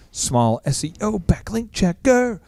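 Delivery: slow, hammed-up drawl with "checker" stretched; drawn-out silly voice. Repeating the site name for fun, slow silly drawl.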